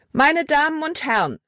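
high crier's call, pitch well up; loud call. A narrator's reading. Libby addresses passengers on the levee, high calling voice. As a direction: call out to gather a crowd. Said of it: "rief sie laut".

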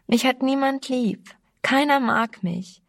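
lamenting. A narrator's reading. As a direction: whimper it like a hurt girl. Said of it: "jammerte sie".

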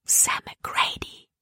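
langsames, tonloses Flüstern. A narrator's reading. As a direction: den Namen leise und gedehnt zuflüstern. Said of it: "flüsterte sie Caleb zu". (leise geflüstert)

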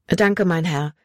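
usual speaking voice

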